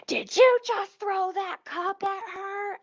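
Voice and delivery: high, slow accusing mock voice; mocking the worker. Imitating the worker who comes flying out to accuse her, high and slow. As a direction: put on a shrill-sounding confrontational coworker. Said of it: "She's like".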